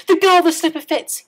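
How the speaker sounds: high, loud proclamation; the prince cries out over the slipper; crying out. A narrator's reading. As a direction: proclaim it to the world. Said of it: "he cried".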